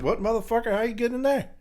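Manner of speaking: high-pitched comic disbelief; mock startled. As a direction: act baffled by an intruder, pitch jumping up. Acts startled at face in toilet.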